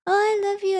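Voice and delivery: high, sing-song playful imitation. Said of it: "usually if you're playful".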